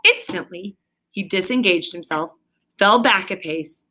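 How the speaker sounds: ordinary delivery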